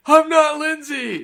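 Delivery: high, slow, loud mock shriek; mock scream. Cohost imitates the girl screaming at the pool, hammed-up shriek. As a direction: ham up a shrill scream. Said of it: "how does she scream".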